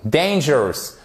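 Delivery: ordinary talk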